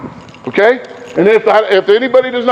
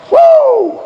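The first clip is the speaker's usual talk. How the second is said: high whooping cry of chatty players; excited whoop; 3 dB louder than usual. Shown as a demonstration. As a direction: show how loud they are off court. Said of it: "Off the floor".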